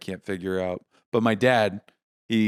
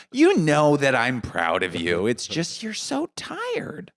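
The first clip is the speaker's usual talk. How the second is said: slow imitation of a mother, climbing very high; fretting mom; 5 semitones higher, slower than usual. Mom qualifying praise of her son, slow fretting. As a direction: drag it out into a high, pitying whine. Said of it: "And she'll go".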